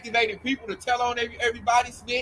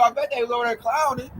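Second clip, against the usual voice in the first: ordinary talk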